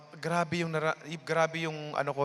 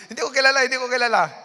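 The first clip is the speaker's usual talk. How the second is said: excited denial; 7 semitones higher, 9 dB louder, faster than usual. Retold or imitated. Laughing, retelling meeting the giver.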